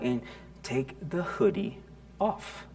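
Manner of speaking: a hushed stern instruction. Retold or imitated. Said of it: "You know, stern".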